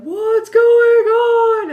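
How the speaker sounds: drawn-out high startled shout; alarmed shout. Retold or imitated. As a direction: shout it as a startled frat boy. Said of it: "They were like".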